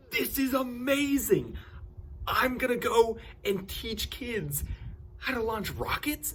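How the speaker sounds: high, loud gushing, hammed up; over-the-top excitement. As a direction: squeal it like a thrilled kid with a new toy. Retold thrilled reaction to rocket kit.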